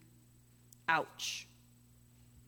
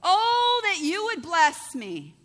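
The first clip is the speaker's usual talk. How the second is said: high, loud, pleading cry, slowing on the last words; a loud pleading prayer; crying out to God; 8 semitones higher, 11 dB louder, slower than usual. Acted. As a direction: call out to God in desperate hope. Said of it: "Jabez cried out to the God of Israel".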